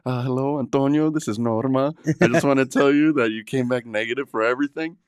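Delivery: a hint of a sweet clinic receptionist leaving good news; clinic receptionist Norma. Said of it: "this little Mexican chick is like".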